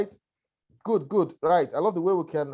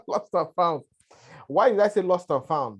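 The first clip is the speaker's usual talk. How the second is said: ordinary delivery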